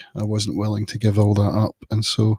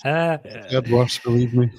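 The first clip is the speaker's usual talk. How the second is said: laughing. Real, not acted. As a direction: laugh it off while talking. Laughing off a bad accent.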